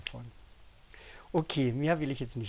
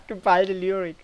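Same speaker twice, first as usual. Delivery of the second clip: laughing, pitched up and much louder; amused laughter; 7 semitones higher, 17 dB louder than usual. Real, not acted. Author jokes about the coming poetry volume, laughing. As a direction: say it through a real laugh. Laughs at own book description.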